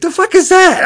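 a sudden high-pitched "what?"; mock baffled squeal; incredulous Noah. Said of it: "Noah's like".

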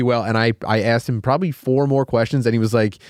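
ordinary talk, nothing marked

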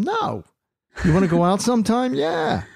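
high-pitched, eager mock phone call; acted-out date call. Host acting out a modern woman calling him, high mock-eager. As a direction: play both sides high and quick.

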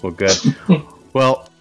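ordinary talk

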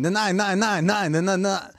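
insistent mock-German refusals; German soundboard. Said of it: "like, the German soundboards".

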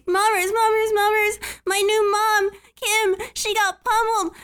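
put-on a small child tugging at grandma; pleading child.

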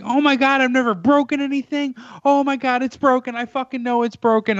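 high-pitched mimicked panic; imitated hysterical screaming. Storyteller voicing girl with broken finger, hysterical. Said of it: "how badly she was screaming".

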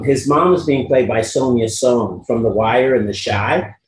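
normal talking voice

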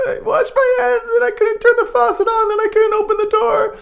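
put-on very high, crying child's wail; high, loud crying kid explaining to his dad; tearful panic. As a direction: blurt it out near tears.

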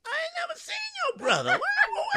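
voice shoots up high. Acted. Girlfriend shrieks high to deny seeing the brother. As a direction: jump way up in pitch to deny it. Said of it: "she went high".

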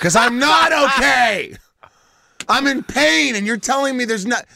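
high, loud mock-desperate protest; stretched-out, high-pitched comic rant; indignant outburst. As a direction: wail it in exaggerated outrage, pitch way up. Acts out outburst at doctor's reassurance.

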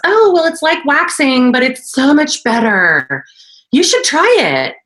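high, bubbly mock voice; imitating the girl. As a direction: play the chirpy girl up high. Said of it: "she said it just like that".

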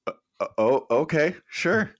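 stammering, rising high mock okay; flustered agreement. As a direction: stumble into a nervous yes. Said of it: "I'm like".

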